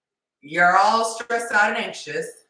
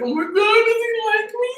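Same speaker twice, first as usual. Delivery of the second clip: much higher, squealing excitement; inner freakout; 5 dB louder than usual. Retold or imitated. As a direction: squeal it like a giddy crush. Inside you're freaking out.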